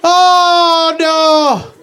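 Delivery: high, wailing voice of a starving Irishman; starving famine victim. Acted. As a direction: moan it out like you're dying of hunger. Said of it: "like a little play".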